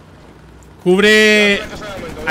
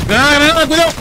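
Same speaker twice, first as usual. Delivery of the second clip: grenade warning yell; 5 semitones higher, faster than usual. Real, not acted. Grenade thrown in firefight.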